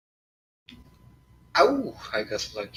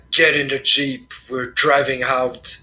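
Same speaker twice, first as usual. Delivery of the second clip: loud, urgent order to a teammate; in-character shout; 6 dB louder than usual. Acted. [name] ordering Roy into the Jeep, shouting. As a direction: shout it across to get him moving. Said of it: "I'm gonna shout at Roy".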